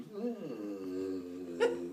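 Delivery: very slow, drawn-out blue with a laugh; stretched blub. Acted.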